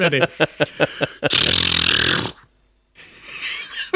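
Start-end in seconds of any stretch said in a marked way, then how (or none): 0.2-2.3 low rattling mouth gunfire; machine gun sounds. Shown as a demonstration. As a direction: spit out a burst of fake gunfire. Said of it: "I want your machine gun impression".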